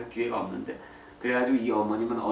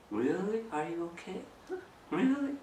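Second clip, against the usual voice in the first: much higher, rising questions; caring dad asking; 9 semitones higher, 4 dB quieter, much slower than usual. Shown as a demonstration. Father checking on his child, high caring questions. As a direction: probe gently, lifting each question. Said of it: "아이들 방에 들어가서".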